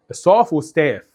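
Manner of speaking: "ستاف وستاف", first "stahf", then "stef"; British "ah", then American fronted vowel in "ستاف وستاف". Shown as a demonstration. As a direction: contrast broad and thin vowels in "ستاف وستاف". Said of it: "لان هي".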